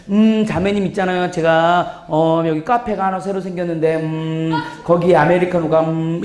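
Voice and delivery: low hesitant hemming; beating around the bush. Shown as a demonstration. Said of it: "제가 막 빙빙 돌려서 말하는 거". (낮게 뜸 들이며 빙빙 돌려 말하기)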